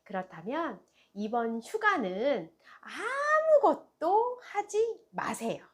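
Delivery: ordinary talk, nothing marked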